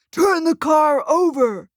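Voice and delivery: high, loud, slowed command; rally the crowd to flip it; yelling. A narrator's reading. Rioter urging the crowd to flip the car, loud yell. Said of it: "He yells".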